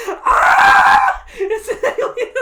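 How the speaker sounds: high, loud laughing scream; hammed-up alien panic scream; retold scream. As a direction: shriek it out, half laughing. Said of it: "I'm screaming like".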